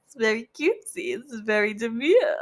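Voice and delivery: mock-demure catchphrase, breaking into laughter; cutesy catchphrase. Reader jokes about doing makeup, mock-cutesy. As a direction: put on a cutesy show of being demure, giggling.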